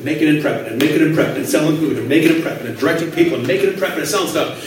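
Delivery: very fast, low run-on patter; imitated rapid prayer chant, words tumbling together; mock praying in tongues. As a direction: rattle it off as if under your breath. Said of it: "I'd be praying in tongues".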